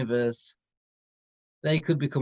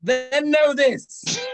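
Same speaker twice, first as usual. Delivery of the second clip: slow, loud and higher, a god passing sentence; booming decree; 8 semitones higher, 5 dB louder, much slower than usual. Acted. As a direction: thunder it out slowly like an ancient power. Deity pronounces judgement.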